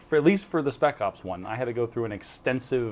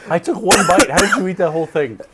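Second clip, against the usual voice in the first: loud, high disbelief; astonished; 6 semitones higher, 10 dB louder than usual. Real, not acted. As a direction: sound amazed, loud and high. Reacting to hot sauce.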